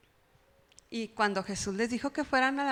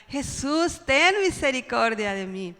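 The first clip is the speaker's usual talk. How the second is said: louder insistent plea for mercy; shouting more; 6 dB louder than usual. Acted. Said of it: "pues él más gritaba".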